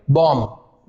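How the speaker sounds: बॉम्ब as "bom", ending on m; correct bomb. Shown as a demonstration. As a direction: close बॉम्ब on the m, B silent. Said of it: "बी silent रहेगा". (बॉम्ब को "बॉम" बोलें)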